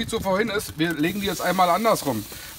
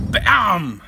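loud catchphrase shout; 7 dB louder than usual. Real, not acted.

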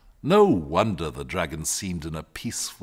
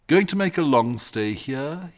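ordinary delivery

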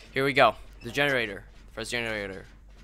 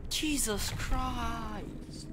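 very slow, drawn out, softer; exasperated groan; 7 semitones higher, 7 dB quieter, much slower than usual. Real, not acted. Gamer fed up with a teammate's blunder, slow exasperated drawl. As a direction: stretch it out, fed up.

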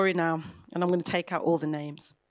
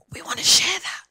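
a sudden breathy whisper, much higher; 10 semitones higher than usual. Real, not acted. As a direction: lean in and whisper it like a secret. Intimate aside in sermon.

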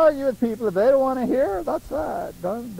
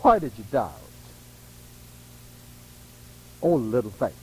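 low soft gentle chiding; gentle rebuke; 10 semitones lower, 5 dB quieter than usual. Retold or imitated. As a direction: chide him kindly, low. Jesus voiced gently.

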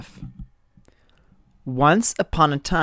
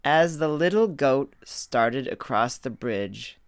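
ordinary delivery, nothing marked

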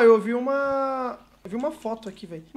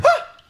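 startled scream; 6 dB louder than usual. Real, not acted. Monster appears.

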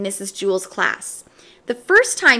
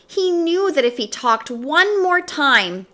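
ordinary delivery

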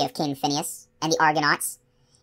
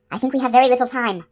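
urgent; 8 semitones higher, 4 dB louder than usual. A narrator's reading. Urgent whisper to rescuer.

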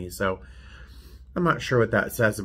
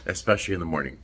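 normal talking voice

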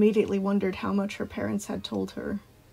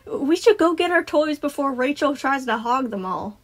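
put-on raised little-girl voice; piping child; 5 semitones higher, 4 dB louder than usual. A narrator's reading. Girl leading friends to the toys, piping up. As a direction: pipe up to hurry your friends. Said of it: "Jess piped up".

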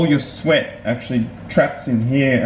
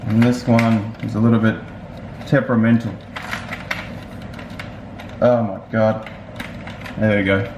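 speaking behind chin guard; wearing full-face helmet. Real, not acted. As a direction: helmet over mouth. Seen 2: Full-face helmet with chin bar worn over mouth throughout the labelled words.

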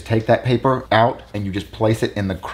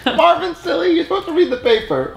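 high woman voice. Acted. Acted woman voice in skit.

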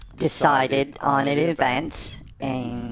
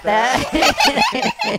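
laughing; 3 dB louder than usual. Real, not acted. Laughs at the forgotten intro.

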